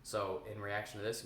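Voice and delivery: usual speaking voice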